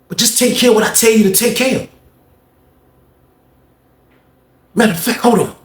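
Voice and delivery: high, bossy imitation of a caller; man on phone. Leroy instructing someone on the phone, high-pitched imitation.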